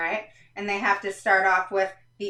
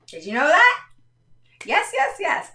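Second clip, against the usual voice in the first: high, delighted yes yes yes; squealing yes yes yes; excited; 9 semitones higher, 7 dB louder than usual. Real, not acted. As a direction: bubble over with excitement. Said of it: "I'm very excited about that".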